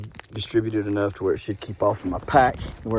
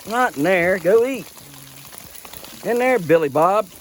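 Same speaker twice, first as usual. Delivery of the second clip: loud, high scolding of goats; exasperated herding; 8 semitones higher, 6 dB louder than usual. Real, not acted. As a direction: holler at a stubborn goat. Exasperated, ordering goats about.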